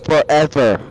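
loud, dramatic doom declaration; dramatic taunting. Pretend. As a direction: boom it out like a grim verdict. Taunting friend about dead parents.

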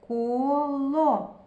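Коло slowly, both syllables held out; drawn-out last О. Shown as a demonstration. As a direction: stretch Коло through to a clear final о.

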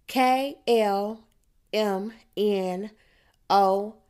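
loud, separated letter by letter; calling out letters. A narrator's reading. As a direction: call each letter to the whole group. Rhonda calls out letters.

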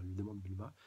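usual speaking voice

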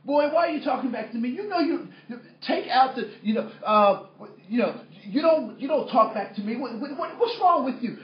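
loud, high, sputtering scolding; upset father. Retold or imitated. Dad scolds his son for talking back, upset. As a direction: imitate an exasperated dad losing the thread. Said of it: "So what does my dad say?".

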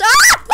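sudden high yell of shock; scream. Real, not acted. Gamer caught off guard by an enemy, a sharp scream. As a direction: yelp as he's jumped.

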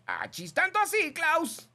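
high, loud squeaky put-on; mock listener voice. Host voicing a listener's surprised reply in a silly high voice. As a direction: squeal it in disbelief.

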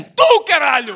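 loud laughing shout; shouted punchline. Retold or imitated. Mocking his friend loudly, laughing. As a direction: hit him with the answer.

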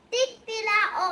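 rooster crow. Shown as a demonstration. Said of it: "ay manok".

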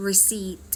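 "receipt" said with no P sound; silent P. Shown as a demonstration. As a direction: say "receipt" as re-SEET, P left silent. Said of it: "ตัว P เราไม่ออกเสียงนะ". (ออกเสียง "receipt" แบบไม่ออกเสียงตัว P)

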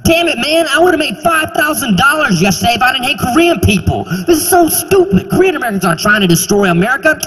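high, exasperated rant. Pretend. Locksmith regretting lost Korean customers, high exasperated rant. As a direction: whine about the money you lost. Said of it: "Would he eventually be like".

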